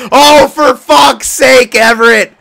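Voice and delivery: stretched-out, high, loud groan of disbelief; exasperated outburst. Real, not acted. Gamer groaning at Everett after a late goal, drawn-out loud outburst. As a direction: let the frustration burst out slowly at the teammate. Teammate blows late lead.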